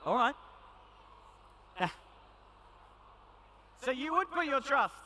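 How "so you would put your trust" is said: teasing disbelief. Real, not acted. Teasing volunteers.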